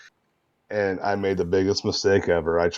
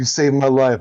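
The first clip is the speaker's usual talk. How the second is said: quoted grateful friend; 6 semitones higher, 6 dB louder than usual. Retold or imitated. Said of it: "He's like".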